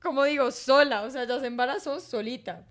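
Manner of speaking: high, loud laughing rant; laughing loudly. Real, not acted. Laughing to her sister about their mother.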